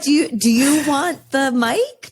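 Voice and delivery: high-pitched, amused offer; playful deference. Retold or imitated. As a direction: hand over your job in mock awe. Jokingly offers student the teacher's role.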